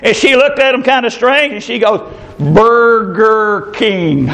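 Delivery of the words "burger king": drawn-out, syllable-by-syllable Burger King; deadpan over-careful enunciation, each syllable stretched; slow and clear. Retold or imitated. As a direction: spell it out by sound, very slowly. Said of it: "speak very solely and clearly".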